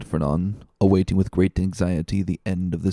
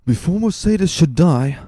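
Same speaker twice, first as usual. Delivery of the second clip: higher, louder, firmly resolved; unshaken resolution; 8 semitones higher, 5 dB louder than usual. A narrator's reading. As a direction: vow it with unbending resolve. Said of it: "with the accents of unshaken resolution".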